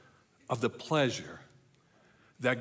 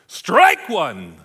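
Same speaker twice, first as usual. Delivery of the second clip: loud umpire calling a strike; punchy shouted game call; umpire's call; 3 semitones higher, 11 dB louder than usual. Acted. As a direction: bellow it out like an umpire.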